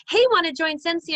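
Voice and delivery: high and loud, gushing sales pitch; overeager pitch. Shown as a demonstration. A recruiter showing a message that reads overeager, high and loud. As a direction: sound way too pushy and eager to join. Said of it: "It can come off as".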